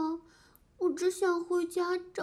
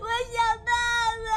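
put-on child wailing; 5 semitones higher, faster than usual. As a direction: wail it desperately. Child crying louder.

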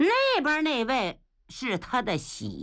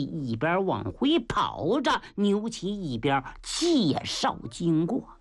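ordinary delivery, nothing marked

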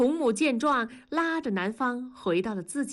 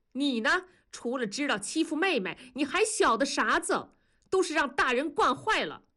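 grandmother in Sichuan dialect, higher; 7 semitones higher than usual. A narrator's reading. Grandmother scolding the girl in Sichuan dialect. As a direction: scold in Sichuan speech like an old granny. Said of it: "用四川话说".

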